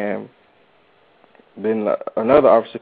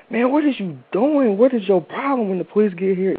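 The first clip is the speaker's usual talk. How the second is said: high-pitched, mock scolding yell without much volume; imitated screaming crowd; 10 semitones higher than usual. Everybody screaming at the helper, imitated high yell. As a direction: re-enact alarmed protest, pitched way up. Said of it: "everybody screaming".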